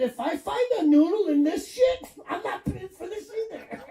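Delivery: high, smug punchline; cocky comeback. Retold or imitated. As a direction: toss it off with a laugh. Punchline retort, high pitch.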